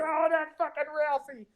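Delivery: high-pitched mocking crowd voice; bar crowd jeering. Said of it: "everyone else is sort of like".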